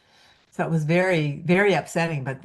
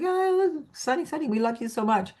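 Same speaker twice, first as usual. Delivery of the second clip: much higher, soft pet-owner coo; Pamela cooing to the dog, much higher; talking to the dog; 8 semitones higher, 3 dB quieter than usual. Retold or imitated. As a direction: sweet-talk the dog. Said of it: "And Pamela was like".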